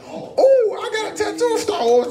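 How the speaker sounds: high-pitched mock excitement, quick and loud; hammed-up eager woman's voice; imitated excited woman. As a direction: squeal it quickly, imitating her. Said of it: "She was like".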